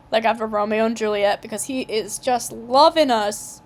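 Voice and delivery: usual speaking voice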